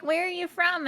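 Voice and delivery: high, lightly put-on asker; mimicked question. Acted question from everyone.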